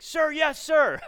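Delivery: loud, high military response; recruit's shouted reply. Retold or imitated. Trainee answers his commanding officer, in a full military shout. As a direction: shout back like a drilled recruit. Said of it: "Imagine that he said that".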